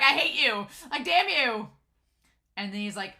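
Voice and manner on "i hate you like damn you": loud, high mock-angry retort; mock exasperation. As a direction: playfully curse them out at raised pitch. Voices Kato's exasperated retort.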